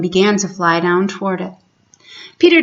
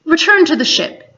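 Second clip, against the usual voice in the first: higher command to the crew; captain's order; 8 semitones higher than usual. Acted. Hook sends his last men back to the ship, commanding.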